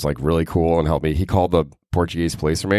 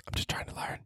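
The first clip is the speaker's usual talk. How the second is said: tiny voiceless whisper; sheepish whisper; 17 dB quieter than usual. Pretend. Excusing himself after his embarrassing story, sheepish whisper.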